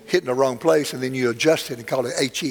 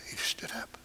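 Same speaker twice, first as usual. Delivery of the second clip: hushed, breathless whisper; 17 dB quieter than usual. Retold or imitated. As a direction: barely voice it, stunned. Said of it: "The translator said".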